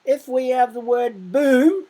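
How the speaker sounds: booming the word out high and loud. Shown as a demonstration. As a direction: make it sound like a big noise. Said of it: "it makes a very loud noise".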